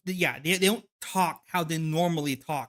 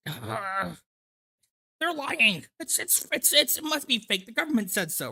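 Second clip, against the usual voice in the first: high, whiny conspiracy rant; hammed-up paranoid panic; mock paranoid whine; 4 semitones higher than usual. Mocking paranoid anti-government streamer.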